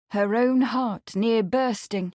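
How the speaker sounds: usual speaking voice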